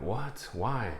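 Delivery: quiet disbelief at sad news; hushed shock. Retold or imitated. Hearing the agent is leaving after a death, hushed shock. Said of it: "And I'm like".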